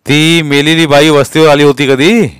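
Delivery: stern interrogator; police inspector. A narrator's reading. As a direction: probe the child firmly.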